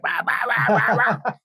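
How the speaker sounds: high-pitched over-the-top babbling impression; Rip Torn. Mimicking an actor in a bad sequel, high manic bah-bahs. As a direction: yap it out high, like a ranting actor. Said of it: "Rip Torn being like".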